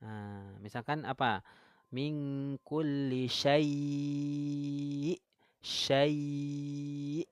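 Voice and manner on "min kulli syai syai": min kulli syai' very slow, ya stretched long; lengthened ya. Shown as a demonstration. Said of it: "ya-nya boleh dipanjangkan".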